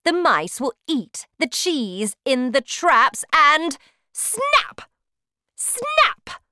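high, shrieking snap snap; gleeful witch. Acted. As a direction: relish the snap like a cruel witch. Witch gleefully describes traps.

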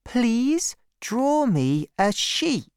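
put-on high, slow pleading child; small boy. A narrator's reading. Boy repeating his request to the pilot, high slow child voice. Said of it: "He said again".

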